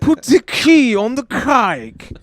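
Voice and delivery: mock Ben Franklin. Franklin repeating the kite line, hammed up. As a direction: proclaim it like Franklin.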